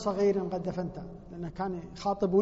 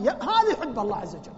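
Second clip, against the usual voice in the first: usual speaking voice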